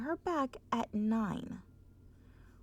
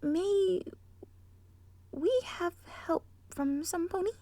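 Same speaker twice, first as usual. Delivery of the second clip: higher, polite plea; Missus Cake voice; 6 semitones higher, 3 dB louder than usual. Acted. Missus Cake asking Twilight for help, higher and polite. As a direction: ask sweetly in a higher voice.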